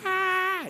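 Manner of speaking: high-pitched, loud mock wail; imitated crying. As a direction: wail like a child left behind. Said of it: "ele chorando". (choro agudo imitado)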